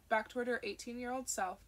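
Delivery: usual speaking voice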